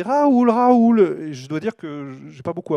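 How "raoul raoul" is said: loud high call across the aisle; a stranger shouting the child's name. Retold or imitated. A stranger in the supermarket calls the boy's name, loud and high.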